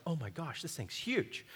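very soft mock awe; retold hushed amazement. Preacher reliving finding giant cantaloupe as a kid, hushed awe. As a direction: play a kid's amazement under your breath. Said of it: "And we were excited".

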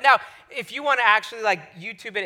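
usual speaking voice

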